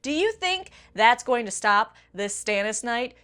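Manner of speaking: normal talking voice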